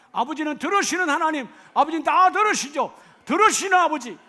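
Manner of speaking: loud, high impassioned preaching cry; full-force preaching. Acted. Preacher crying out David's plea to the congregation, impassioned and loud. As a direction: pour it out to the congregation like a prayer.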